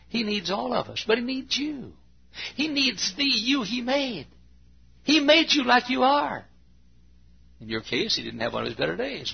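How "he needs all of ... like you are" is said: much higher, rising sermon voice; preaching at full force. Acted. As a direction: lift the congregation with fervour. Preaching climax.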